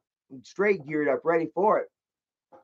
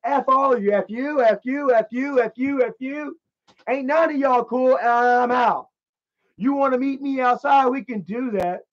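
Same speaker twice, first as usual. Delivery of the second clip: retold angry taunts; 6 semitones higher than usual. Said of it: "I said".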